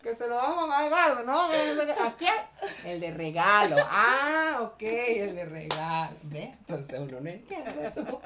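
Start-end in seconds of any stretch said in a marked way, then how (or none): 0.0-6.2 agudo y fuerte, sin entender; diálogo a gritos. Pretend. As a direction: grita preguntando qué dijo. (malentendido gritado por la mascarilla)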